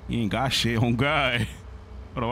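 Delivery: ordinary delivery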